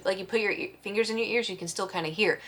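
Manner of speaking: ordinary delivery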